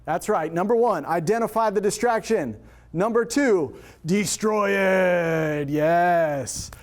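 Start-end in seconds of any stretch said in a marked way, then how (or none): 4.0-6.7 slow, drawn-out shout of destroy it; rallying shout. Shown as a demonstration. Speaker leading the room in a chant, slow rallying shout. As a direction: punch it out like a battle cry. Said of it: "Say it with me".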